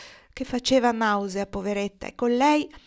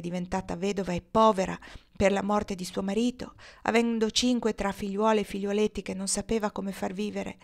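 ordinary delivery

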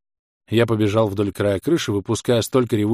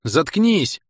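loud high snap; yell; 7 semitones higher, 4 dB louder than usual. A narrator's reading. Hero yelling at the enemy to shut up, loud yell. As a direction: shout him down. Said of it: "завопил я".

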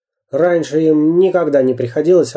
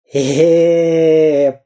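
croaked groan; hoarse wheeze; 2 semitones higher than usual. A narrator's reading. Crushed Dyrka wheezes, hoarse croak. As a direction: rasp out a strained groan. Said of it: "прохрипел Дырка".

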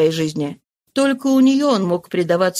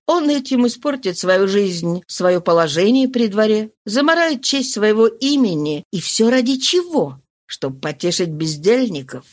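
ordinary delivery

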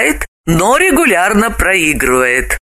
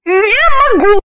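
much higher, louder woman's voice; high, loud female no; refusal; 4 dB louder than usual. Acted. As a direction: refuse shrilly. Galina refuses in fable.

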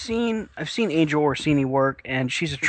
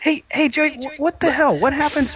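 startled outburst; 12 semitones higher than usual. Real, not acted. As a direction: blurt it out in shock. Sudden outburst at co-host.

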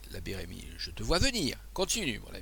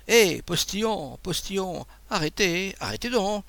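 crié plus aigu et plus fort, lentement; héler le cocher au loin; appel au postillon; 8 semitones higher, 3 dB louder than usual. Acted. (l'abbé hèle le postillon pour arrêter la voiture)

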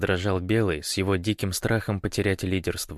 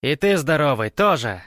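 high, loud, commanding woman; stranger woman's voice; 7 semitones higher, 6 dB louder than usual. A narrator's reading. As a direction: order him about as an armed intruder. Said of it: "Голос у новоприбывшего был хриплый, но явно женский". (властный женский голос, громко и высоко)

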